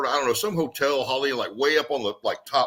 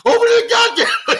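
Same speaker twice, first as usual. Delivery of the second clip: laughing high yell; 8 dB louder than usual. Retold or imitated. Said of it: "He's like".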